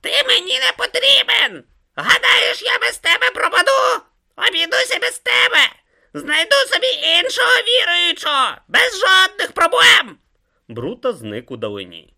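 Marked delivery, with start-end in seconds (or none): 0.0-10.2 loud, very high-pitched ranting; screaming tantrum. A narrator's reading. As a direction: shriek after someone who is walking away. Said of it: "репетував Ом".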